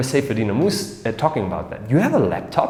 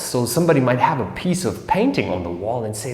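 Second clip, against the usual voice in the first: ordinary delivery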